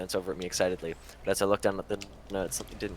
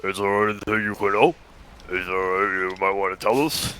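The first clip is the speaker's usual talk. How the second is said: deeper, more realistic redo; extremely deep bass voice; 6 dB louder than usual. Acted. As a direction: push it down into a bass rumble. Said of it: "She had to make it more realistic".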